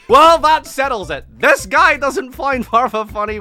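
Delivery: high, booming mock concession; loud sarcasm. Streamer mocking a critic's argument, loud sarcasm. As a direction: exclaim it as if the case is closed.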